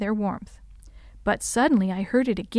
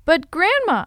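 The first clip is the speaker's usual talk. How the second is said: put-on high, louder child's objection; pitched-up protest to a grandmother; 8 semitones higher, 5 dB louder than usual. A narrator's reading. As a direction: plead your case in protest. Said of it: "I protested".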